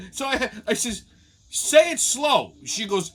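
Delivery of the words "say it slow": loud, drawn-out instruction; slow and emphatic. Retold or imitated. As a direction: spell it out slowly and loudly for someone not understanding. Asks bartender to slow down.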